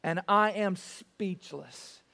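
slow stunned. Shown as a demonstration. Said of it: "which basically means".

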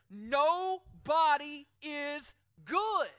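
NOBODY IS GOOD drawn out very slow, high and loud; emphatic. Real, not acted. Preacher declaring what the Bible makes clear, emphatic. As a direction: hammer out each word of "Nobody is good", slowly. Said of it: "That's emphatic".

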